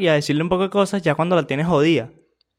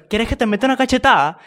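higher, louder, quick mock challenge; imitated challenge; 7 semitones higher, 5 dB louder, faster than usual. Commentator voicing one fighter daring the other, loud quick challenge. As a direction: throw it out as a mock threat. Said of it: "Y la otra".

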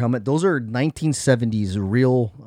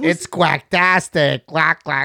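duck voice; 4 semitones higher, 5 dB louder, slower than usual. Retold or imitated. Quoting Billy Madison duck line.

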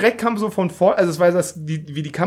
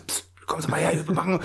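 mock hushed call; 2 semitones lower, 9 dB quieter than usual. Imitating a secretive pickup.

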